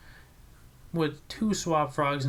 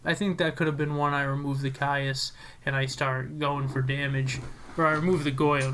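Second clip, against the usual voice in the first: ordinary talk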